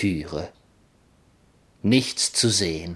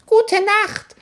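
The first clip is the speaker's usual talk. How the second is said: very high, squeaky tiny voice; baby voice; 6 dB louder than usual. Acted. The baby in the suitcase says good night, very high. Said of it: "sagte das Baby".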